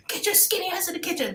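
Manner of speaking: high, commanding grandma voice; grandma ordering the girls into the kitchen; bossy grandma order. Retold or imitated. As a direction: bark it like a Southern grandma. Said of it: "she's freaking out".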